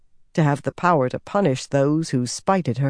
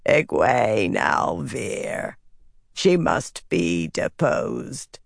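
very slow, low, ominous rasp; rasping dark figure; 6 semitones lower, much slower than usual. A narrator's reading. Dark figure ordering a leader's removal, rasping. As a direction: rasp the order out, drawn long and deep. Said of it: "a rasping voice said".

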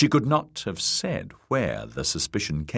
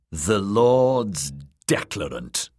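slow, scornful sneer at the title; derisive snort; 2 semitones higher, slower than usual. A narrator's reading. Corbray scoffing at the lords declarant, derisive. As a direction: say it slowly with open derision. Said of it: "Lynn Corbray snorted".

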